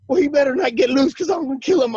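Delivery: high-pitched imitation of an irate uncle; yelling threat. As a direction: threaten the dog, re-enacting uncle's rage. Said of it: "he's yelling and screaming".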